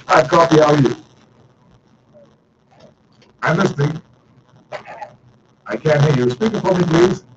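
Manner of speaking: bright, loud on-air phone greeting; radio host greeting callers. Acted. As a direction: welcome the caller, project it. Answering call-in lines on air.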